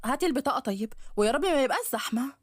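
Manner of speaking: a sharp, needling stepmother taunting her stepdaughter; nagging stepmother. Acted.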